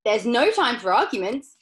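loud, brisk dismissal of objections; Mister Wonka crying out. A narrator's reading. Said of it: "cried Mister Wonka".

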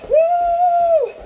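high loud whoop; excited cheer. Real, not acted. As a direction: whoop to fire up the class. Cheering mid-exercise.

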